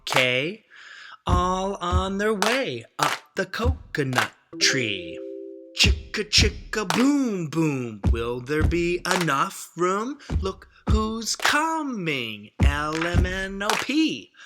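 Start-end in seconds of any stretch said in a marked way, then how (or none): none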